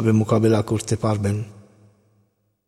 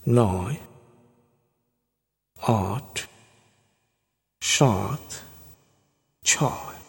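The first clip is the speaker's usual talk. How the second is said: slow hypnotist voice; 2 semitones lower than usual. Acted. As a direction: count down slowly to put them under. Slow hypnotic countdown to zero.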